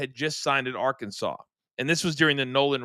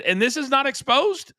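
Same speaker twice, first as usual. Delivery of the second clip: much higher, rising disbelief; incredulous question; 12 semitones higher, 3 dB louder than usual. Retold or imitated. Captain questioning recruit about secret payments, high-pitched incredulity. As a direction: climb in pitch as if astounded.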